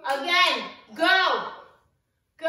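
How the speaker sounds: loud push to go again; urging shout. Real, not acted. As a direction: shout it like a coach. Pushing through workout.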